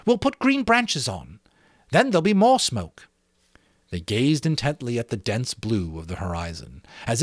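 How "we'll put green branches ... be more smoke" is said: lightly voiced boy, higher, pleased with himself; proud schoolboy. Jack taking charge before the assembly, proud. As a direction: boast a little about being English.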